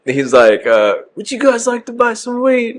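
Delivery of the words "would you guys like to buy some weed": very high mock London accent; put-on London accent. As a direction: pitch it way up as an imitated Londoner. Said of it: "You gotta hit him with the accent".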